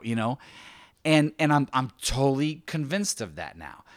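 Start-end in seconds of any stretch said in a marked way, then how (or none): none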